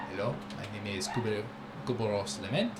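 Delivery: quiet deep introduction. Acted. Leader introduces himself.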